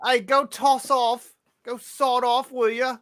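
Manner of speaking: mock British.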